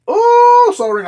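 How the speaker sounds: a sudden high, loud "ooh" of delight at the pull; excited ooh. Real, not acted. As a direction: squeal it out, genuinely thrilled by the card. Pulls a Sol Ring from pack.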